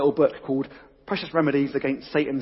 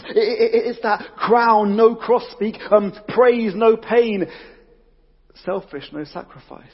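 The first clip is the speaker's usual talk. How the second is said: intense emphatic list; 6 semitones higher, 5 dB louder than usual. Real, not acted. Preacher names the temptation intensely.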